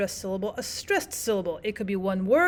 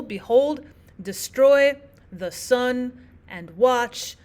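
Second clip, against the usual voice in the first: weak-strong pairs, landing on HOLD, STROY, sun, watch; iambic stress; 4 dB louder, much slower than usual. Shown as a demonstration. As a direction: stress the second syllable of Behold and destroy, SUN and WATCH after weak words. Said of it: "unstressed syllable versus stressed syllable".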